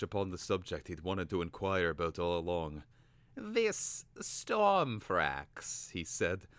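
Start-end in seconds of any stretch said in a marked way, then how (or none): 3.3-5.9 high, very slow casual tone; feigned offhand. A narrator's reading. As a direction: pretend not to care. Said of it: "in as offhand a manner as he could manage".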